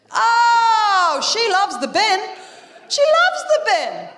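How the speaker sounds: high, rising to a drawn-out cry; emphatic exclamation. Real, not acted. Mum marvelling at toddler's love of the bin, emphatic. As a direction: gush it with comic exaggeration.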